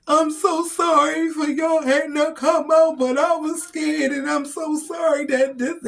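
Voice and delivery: high, loud, tearful apology through sobs; crying apology. Retold or imitated. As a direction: plead sorry while choking up. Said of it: "I guess I was a crying baby today".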